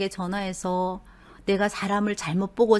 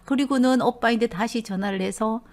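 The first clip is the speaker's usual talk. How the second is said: ordinary talk, nothing marked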